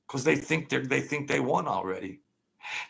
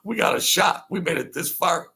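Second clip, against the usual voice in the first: mock excited; 7 semitones higher than usual.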